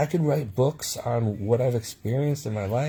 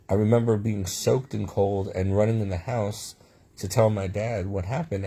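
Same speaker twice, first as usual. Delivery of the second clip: normal talking voice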